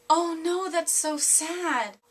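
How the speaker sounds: drawn-out, mock-sympathetic "oh no"; sympathetic dismay. Granddaughter acts out grandma hearing of a singer's death, drawn-out sympathy. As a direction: lay on slow, sugary sympathy.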